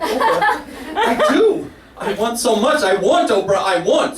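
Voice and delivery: high, breathless mock longing for Oprah; mock swooning. Comedian swooning at Oprah on her magazine cover, breathless and high. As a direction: swoon up high like a smitten fan. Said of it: "I'm like".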